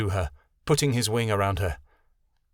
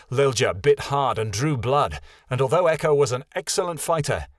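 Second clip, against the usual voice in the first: plain reading voice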